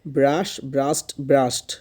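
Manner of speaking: "ব্রাশ", then "ব্রাশড" with a hard closing d/t; teacher drilling the added consonant in "ব্রাশড"; stressed final D. Shown as a demonstration. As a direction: press on the last sound of "ব্রাশড". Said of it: "জোর দিয়ে হচ্ছে ডিটা উচ্চারণ করতে হবে".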